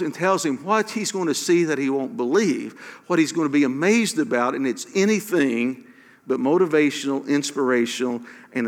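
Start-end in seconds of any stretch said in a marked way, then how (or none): none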